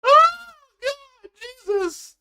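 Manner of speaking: sudden high cry breaking into a slow wail; anguished wail. Pretend. Streamer wailing in mock despair at bad gameplay, anguished cry. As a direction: wail like the game just broke your heart.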